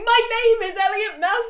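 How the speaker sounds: high, loud mock announcement; Elliot Maslow. Running around the camp introducing himself, loud mock calling. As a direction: call it out fast to the whole camp. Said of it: "He's running around the camp going".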